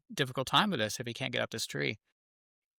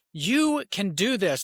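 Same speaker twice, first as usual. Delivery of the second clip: emphatic encouragement; 6 semitones higher, 4 dB louder, slower than usual. Retold or imitated. Dad coaching his crying son.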